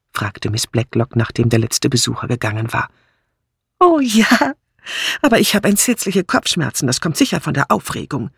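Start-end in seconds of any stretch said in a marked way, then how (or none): 3.8-8.3 high-pitched voice falling as she complains; fretful Dora. A narrator's reading. Dora owning up to a headache after her party, high and fretful. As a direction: answer high and eager, then fret.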